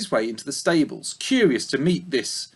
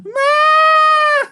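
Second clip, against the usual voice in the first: very high, loud sheep cry; old ewe answering the piglet's cry; old ewe calling; 8 dB louder than usual. Acted. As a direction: bleat back from next door. The voice next door.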